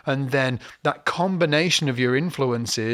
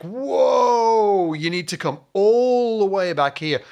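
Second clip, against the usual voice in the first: retold shout; 8 semitones higher, slower than usual. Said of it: "he was like".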